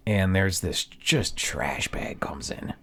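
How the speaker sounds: slow hushed whisper. Retold or imitated. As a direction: whisper it like a dramatic reveal. Story building to arrival.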